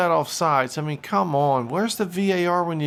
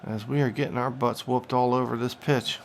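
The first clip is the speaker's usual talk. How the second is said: play-by-play commentary. Acted. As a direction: call the action like a TV commentator. Commentates his own FIFA match.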